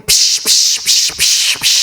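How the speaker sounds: repeated hushing splash noises; imitated swimming splashes. Priest retells swimming in panic, imitated splashes. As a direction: make rhythmic "psh" strokes like frantic swimming. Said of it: "langoy na po ako ng langoy".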